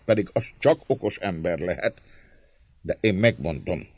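magas, hangos szónoki hang; szólj derűsen az egész tömeghez; jókedvű népszónok. A narrator's reading. (tréfás népszónokként, emelt hangon)

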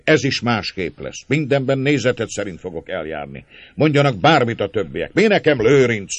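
loud, high, fervent declaration; impassioned pleading. Acted. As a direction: build to an ardent, full-voiced vow. György pleads with his brother passionately.

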